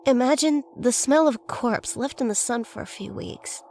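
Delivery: ordinary delivery, nothing marked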